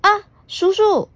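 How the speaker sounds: put-on high, bright child call to her uncle; little girl calling. Puna greeting her uncle, high child voice. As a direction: call out as a small excited girl.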